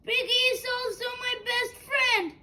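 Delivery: usual speaking voice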